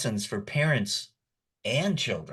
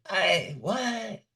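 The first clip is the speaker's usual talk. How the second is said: baffled; 9 semitones higher than usual. Retold or imitated. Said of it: "I was all like".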